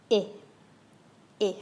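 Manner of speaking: «Eh» said short and sharp with a spread, smiling mouth, French é; «Eh» short, sharp, lips pulled back; French é demo. Shown as a demonstration. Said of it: "pull your lips right back and up".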